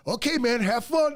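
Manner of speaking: high, loud sarcastic have fun, ending in a shout; sarcastic goodbye to a buddy about to be arrested; mocking send-off. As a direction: brush him off with a mocking yell. Mocking panicked buddy on phone.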